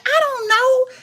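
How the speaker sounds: high, squeaky, hammed-up cluelessness; mock innocence. Retelling her answer to her son, mock innocent. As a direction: play dumb in a high voice.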